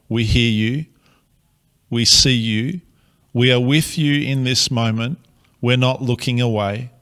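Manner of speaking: normal talking voice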